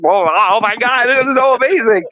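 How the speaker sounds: high gushing wonder; a touch of starstruck delight; gushing awe. Retold or imitated. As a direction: gush like a kid. Retold awe at birds.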